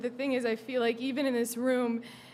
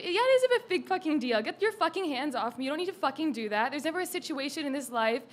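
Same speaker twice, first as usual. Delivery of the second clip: usual speaking voice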